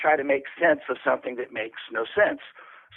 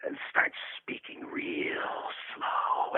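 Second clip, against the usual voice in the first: imitating a coach's hushed slow direction; whispered slow instruction; 10 semitones lower, 10 dB quieter, much slower than usual. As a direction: drop to a slow breathy whisper. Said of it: "And he's like".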